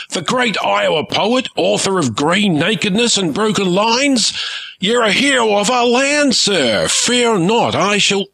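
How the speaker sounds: pirate captain fawns over a famous poet, excited; gushing fan. Acted. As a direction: gush over a hero you've just met. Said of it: "the pirate cried".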